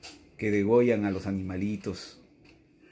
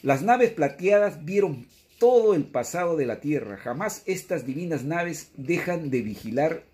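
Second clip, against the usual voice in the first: raised, higher formal reading of the text; reading scripture; 5 semitones higher, 4 dB louder than usual. Acted. Preacher reads the text to the congregation, in a raised formal voice. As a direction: read it out like a prophecy to the congregation.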